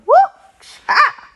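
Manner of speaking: very high, loud whooping yelp; laughing whoop. Real, not acted. Vlogger hyping herself up, a loud laughing whoop. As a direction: let out a gleeful whoop and laugh.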